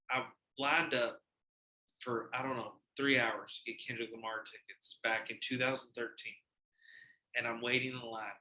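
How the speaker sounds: usual speaking voice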